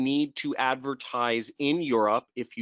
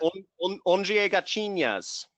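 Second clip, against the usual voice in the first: attempt at Portuguese; 7 semitones higher than usual. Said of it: "You know Portuguese very well".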